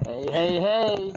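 talking through a Halloween mask; muffled behind mask. Real, not acted. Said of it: "looking on a screen with this mask on".

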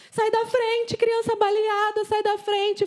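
high, pressing call to move aside; urgent call to clear the way. Retold or imitated. Man driving the wounded child to hospital, clearing traffic with high urgent calls. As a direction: get them out of the road, fast and high.

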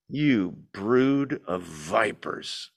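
slow, low and heavy, dragging each word; slow low rebuke. Shown as a demonstration. Preacher voicing John's rebuke to the crowd, slow and heavy. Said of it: "There's no way to read this, by the way, with a gentle, kind".

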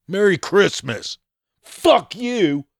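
high sarcastic sing-song, then a sharp curse; mock snarky greeting. Mocking the offended intern with a sarcastic high sneer.

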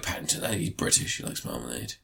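breathy whisper, mocking. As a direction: whisper it like a know-it-all. Mocking naive people.

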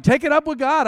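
high, loud brush-off; exasperated retort. Retold or imitated. Preacher answering angry people, loud brush-off. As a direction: throw it back at them loudly. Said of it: "but I'm like".

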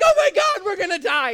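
high, loud hammed-up wail of terror; mock panic. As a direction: overdramatize the disciples freaking out. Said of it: "the disciples are absolutely panicking".

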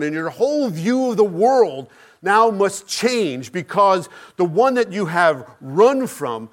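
normal talking voice

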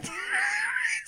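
very high, wheezing squeal of laughter; real cracking-up laugh; helpless laughter. Real, not acted. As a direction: lose it completely mid-story. Cracks up at the errand.

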